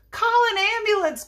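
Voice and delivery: high-pitched, loud call for help; crying out. A narrator's reading. Lady calls for help at the scene, crying out. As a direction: cry out urgently over a crowd. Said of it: "cried the lady".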